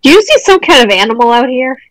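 ordinary talk

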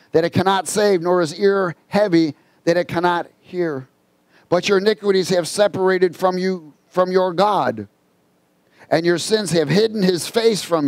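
scripture reading. Real, not acted. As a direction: declaim the verse in measured phrases. Reads scripture aloud while preaching.

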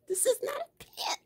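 squeaky high, laughing mock lecture; high-pitched teasing correction. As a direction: protest in a very high voice, tickled by your own joke. Mock-correcting Southern pronunciation, laughing.

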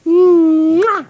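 big smacking kiss; loud kiss smack. Real, not acted. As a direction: blow a loud noisy kiss. Mother kissing her baby.

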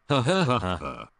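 spelled-out mock laugh, posh; fake laugh. Sly denial, mock laughter.

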